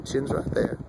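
ordinary talk, nothing marked

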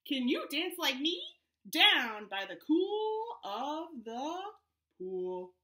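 animated read-aloud to a Zoom class; reading to children. Acted. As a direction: read it out bouncy for little kids. Reads picture book aloud to kids.

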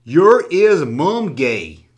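reads each word slowly, like sounding it out; slowly sounding out a confusing comment; slow halting reading. Shown as a demonstration. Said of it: "I'm not the best person in the world when it comes to reading".

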